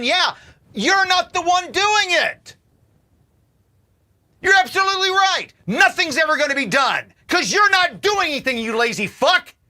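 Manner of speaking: high, loud furious yelling; furious rant. Real, not acted. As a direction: blast them with loud scorn. Ranting at lazy complainers.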